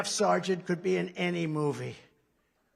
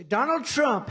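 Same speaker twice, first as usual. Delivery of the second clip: mock headline; 6 semitones higher, 6 dB louder, slower than usual. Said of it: "they say".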